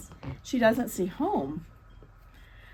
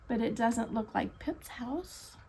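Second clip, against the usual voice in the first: whispered aside; 3 semitones lower, 8 dB quieter than usual. Real, not acted. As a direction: lean in and whisper.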